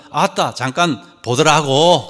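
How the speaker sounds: put-on slowed, drawn-out Jeolla accent; Jeolla dialect. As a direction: imitate a Jeolla speaker, stretching the ending. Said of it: "전라도 사람들은".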